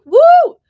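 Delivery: high, very loud "woo"; short celebratory whoop; cheering whoop. Real, not acted. Cheering a husband's week of vacation, loud high whoop.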